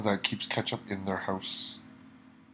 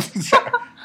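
high laugh; 7 dB louder than usual. Real, not acted. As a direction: burst of high-pitched laughter. Laughs at fries joke.

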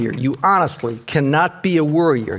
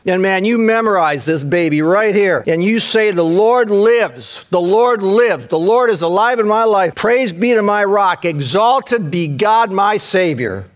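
preaching at full force; 6 semitones higher, 4 dB louder than usual. Acted. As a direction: build to the final shout of praise. Climactic preaching on Psalm 18.

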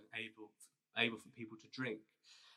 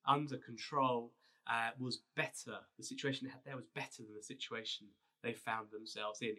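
ordinary delivery

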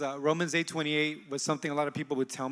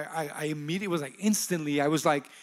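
ordinary delivery

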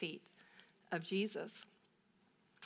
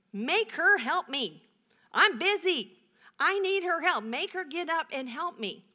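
high, loud, indignant whine; Martha's exasperated protest, pitched up and loud; demanding complaint; 8 semitones higher, 6 dB louder than usual. Retold or imitated. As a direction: play the harried sister demanding help. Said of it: "Can you imagine talking to Jesus that way?".

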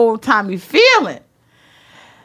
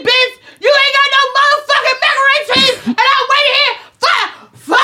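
screaming in fury, much higher and louder; 9 semitones higher, faster than usual. Retold or imitated. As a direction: build into a full furious yell. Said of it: "I was screaming so loud".

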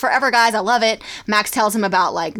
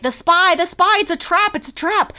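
much higher urgent warning; frantic kid warning; 9 semitones higher than usual. Retold or imitated. Kid warning the lab it's a trap, high and frantic. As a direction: panicky kid cry, high. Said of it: "they're like".

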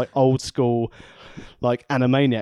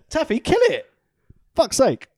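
urging cat; 4 dB louder than usual. Real, not acted. Urging cat to kill mouse.

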